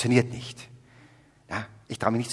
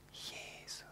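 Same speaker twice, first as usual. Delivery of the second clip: breathless soft whisper of a name; whispered Jesus; 23 dB quieter than usual. Retold or imitated. Believer meets Jesus, whispering his name in awe. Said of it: "Und dann werde ich sagen".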